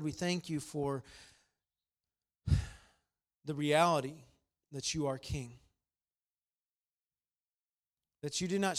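earnest prayer to God, opening loud then settling; closing prayer. Acted. Pastor leading the congregation in closing prayer, earnest. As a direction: pray sincerely for the room.

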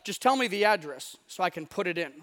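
loud, pushy request for an address; lightly voiced disciple wanting directions; impatient demand. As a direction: disciple pressing Jesus for the address. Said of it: "they're like".

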